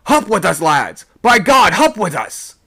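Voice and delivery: high, loud yell to the lads; urgent hollered command; rallying shout. A narrator's reading. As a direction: rouse the men to climb. Sailor rallies men to climb.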